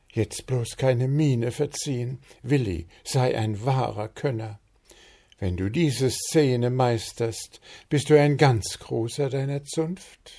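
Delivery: high, urgent inner voice; nervous self pep talk. A narrator's reading. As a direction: steel yourself before the kiss. Willi pep-talks himself.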